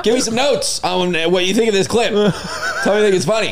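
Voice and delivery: giggling through the request; laughing. Real, not acted. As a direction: laugh while asking. Laughing while asking for feedback.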